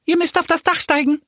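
very high, rapid chicken squawk; hen calling out. A narrator's reading. Hen calls to the two friends leaving, quick high squawk. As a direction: cluck it out fast and squeaky. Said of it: "Da ruft ein Huhn".

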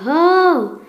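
loud high call to horse. Acted. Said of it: "versuchte Maurice, sein Pferd zu beruhigen". (lauter Ruf zum Pferd)

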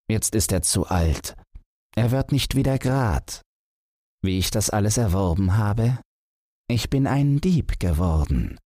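lightly voiced grown son, proudly explaining; son telling his story. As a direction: confess it openly to the old father, lightly voiced. Son confesses to his father.

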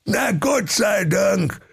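hoher, langsamer Königsruf; rufender König. Acted. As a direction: erleichtert hoch rufen. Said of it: "Der König rief". (erleichterter König ruft)

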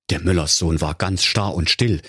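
plain reading voice

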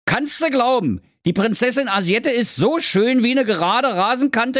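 very high, pushy little king; very high king voice calling a lad back; King Curry. Acted. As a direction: bark him to a halt like a squeaky king. King Curry explains his tax problem.

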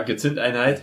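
usual speaking voice

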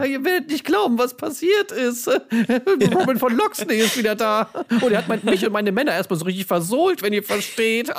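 high, laughing fake cheer; bad news told laughing; forced cheerful. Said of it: "auf fröhliche Weise erzählen".

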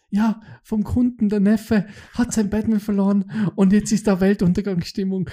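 imitating an employee reporting a catastrophe; mock dramatic employee. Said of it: "schaut so zu ihm auf und sagt so". (Mitarbeiter meldet dem Chef gespielt dramatisch die Lage)

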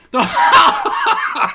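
high, squealing burst of laughter. Real, not acted. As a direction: laugh out in shock at the heat. Spice hits, bursts out laughing.

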